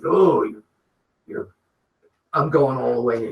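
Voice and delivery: lightly voiced gruff wrestler, growling at him; a hint of a menacing wrestler squaring up; rough imitation of an angry wrestler; Randy Savage. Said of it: "worst Savage impression I've ever".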